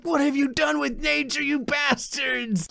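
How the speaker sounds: eco master voice. Pretend. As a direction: scold them for ruining nature. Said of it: "He's like".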